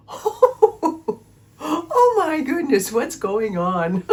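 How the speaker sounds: high startled laugh-cry of disbelief at a flooded basement; shocked discovery. Retold or imitated. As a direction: gasp out your surprise at the flood while laughing.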